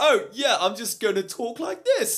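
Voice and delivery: put-on high, overblown British accent; hammed-up British accent, slow and high; John Oliver. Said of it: "who definitely overblows his British accent".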